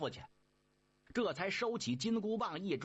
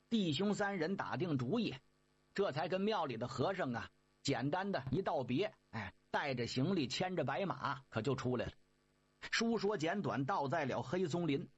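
ordinary delivery, nothing marked

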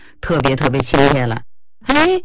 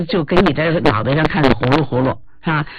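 ordinary talk

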